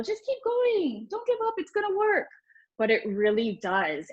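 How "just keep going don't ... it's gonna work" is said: a high, sugary pep talk; high encouraging. Retold or imitated. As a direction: chirp it way up high like a cheerleader. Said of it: "it's so hard to just tell people".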